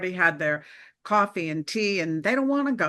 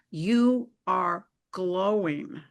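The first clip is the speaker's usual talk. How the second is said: a slow, deliberate, awed verdict; slow pronouncement; much slower than usual. Retold or imitated. Lecturer slowly telling Anne she is glowing. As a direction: say it slowly, each word weighed.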